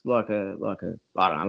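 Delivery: ordinary talk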